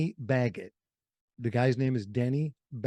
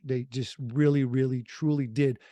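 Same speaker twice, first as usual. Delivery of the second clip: ordinary delivery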